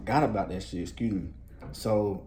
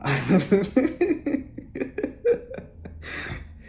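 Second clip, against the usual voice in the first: high burst of real laughter; cracking up at his own "hell nah"; big laugh; 11 semitones higher, 4 dB louder than usual. Real, not acted. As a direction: laugh out loud, pitched up.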